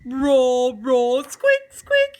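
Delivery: loud roaring then a high squeak; lion roar and squeak. Acted. Tin lion roaring at the children, loud then squeaky. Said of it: "the Tin Lion was going".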